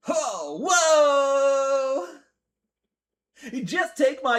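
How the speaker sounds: loud high startled whoa; surprised shout. Real, not acted. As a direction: react to a sudden shock. Sudden surprise in game.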